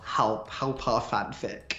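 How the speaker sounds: ordinary talk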